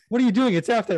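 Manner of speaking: high, loud mock referee protest; mock baffled ref. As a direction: play the flustered ref calling it out. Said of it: "The ref's like".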